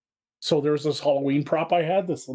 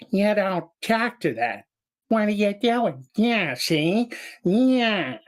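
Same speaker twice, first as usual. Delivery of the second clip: slow, higher cartoon gangster voice; drawling little cartoon mobster, pitched up; stretched-out gangster; 6 semitones higher, slower than usual. Shown as a demonstration. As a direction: take your time, drawing out each word. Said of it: "started stretching out the words".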